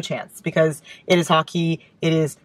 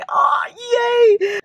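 much higher, louder delighted squeal; excited squeal; 3 dB louder than usual. Real, not acted. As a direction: squeal with joy. Remembers meeting the sender.

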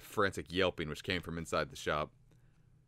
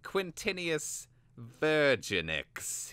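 high, slow, pained naming; lightly voiced bitter schemer; wincing Vilnix; 9 semitones higher, much slower than usual. A narrator's reading. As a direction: say the rival's name through a wince. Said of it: "Vilnix winced".

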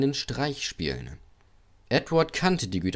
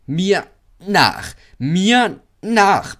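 high, loud, rhythmic puffing call; eager little engine puffing; 9 semitones higher, 8 dB louder, much slower than usual. A narrator's reading. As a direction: chant it like a chugging engine. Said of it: "paffte er".

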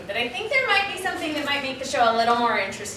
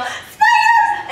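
very high, loud, alarmed yell; 8 dB louder than usual. Shown as a demonstration. Director showing kids their spider reaction, high yell. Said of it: "So you're gonna yell".

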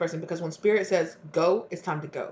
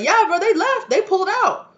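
much higher and louder, laughing it out; gleeful news; 10 semitones higher, 6 dB louder than usual. Acted. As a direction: laugh while breaking the news. Friend gleefully reports they left.

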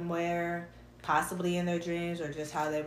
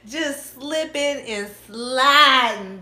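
loud, slow, high drawn-out tease; sing-song teasing drawl; 6 semitones higher, 10 dB louder, much slower than usual. Real, not acted. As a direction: stretch every word out playfully.

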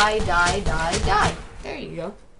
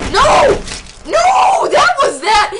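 yelling no at the screen, high and loud; real gamer outburst; frustrated shout; 8 dB louder, much slower than usual. Real, not acted. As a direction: cry out as the round is lost. Losing a game round, shouting.

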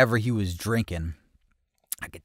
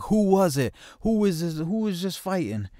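high, raised-voice imitation; retold heated demand; 10 semitones higher, 3 dB louder than usual. Teller voices a dude hunting the fighter, heated demand. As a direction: demand the culprit, as the worked-up dude.

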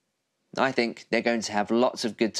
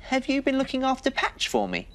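put-on higher, friendly little girl voice; higher voice for the girl on the swings; 12 semitones higher than usual. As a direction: greet him like a small girl. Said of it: "the girl said".

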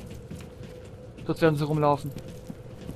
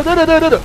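mock gunfire; 9 semitones higher, 6 dB louder, faster than usual. Mimicking gunfire in fight.

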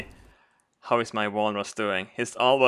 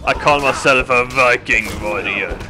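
louder, chest-out proclamation; boastful Viking; 4 dB louder than usual. Pretend. Player posing as godlike Viking, hammed-up boast. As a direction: proclaim it like a mighty warrior.

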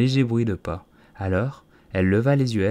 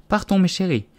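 high, anxious mother canary; high-pitched fretful bird; trembling voice; 9 semitones higher, 4 dB louder than usual. A narrator's reading. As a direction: urge the children away nervously. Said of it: "d'une voix tremblante".

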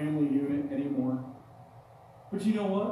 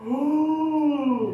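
mock ooh; 8 semitones higher, 7 dB louder than usual. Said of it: "And I said".

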